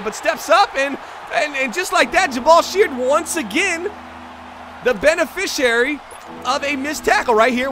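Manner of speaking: high, loud sportscaster call; excited play-by-play. Real, not acted. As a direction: build up the big play for viewers. Live call of the tackle.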